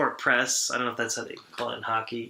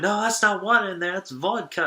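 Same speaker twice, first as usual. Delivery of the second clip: higher, mock Boston accent heckle; 7 semitones higher than usual. Guest imitates the fan heckling the goalie, Boston accent. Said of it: "just in the Boston accent".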